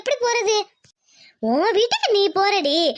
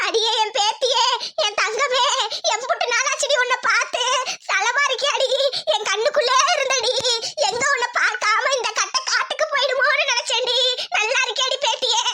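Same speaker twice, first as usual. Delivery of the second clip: put-on joyful old grandmother; 4 semitones higher than usual. Grandmother greets granddaughter, laughing.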